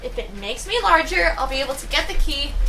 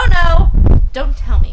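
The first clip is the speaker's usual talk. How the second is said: ordinary delivery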